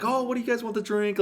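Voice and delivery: high, sugary server voice; sweet waitress voice. Retold or imitated. Waitress sweetly asking the ladies about drinks, high and bright.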